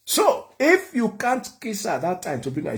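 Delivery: normal talking voice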